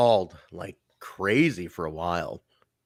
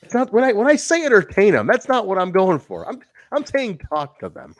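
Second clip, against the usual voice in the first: exasperated; 10 semitones higher, 6 dB louder than usual. Real, not acted. Laughing correction of co-host.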